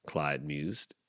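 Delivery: ordinary delivery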